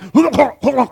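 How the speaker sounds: high, mock gurgling choke; imitated choking. Preacher shows wife he cannot breathe, imitated choking. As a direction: act out choking on water. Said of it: "then I started choking".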